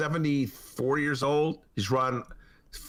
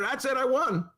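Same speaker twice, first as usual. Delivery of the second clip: mock Trump boast; 9 semitones higher than usual. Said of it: "so he declared".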